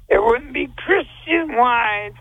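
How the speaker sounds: usual speaking voice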